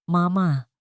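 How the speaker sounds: a light call of Mama; lightly voiced children calling Mama; children's call. A narrator's reading. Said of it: "Sigaw nilang dalawa".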